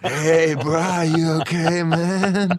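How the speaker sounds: high, loud surfer dude; slow, stretched-out surfer bro. Pretend. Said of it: "shapeshifted into a surfer dude".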